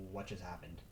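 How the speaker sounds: dropped to a soft, stunned murmur; quietly replaying disbelief; stunned hush. Trader recalling his thought after a sudden loss, dazed and soft.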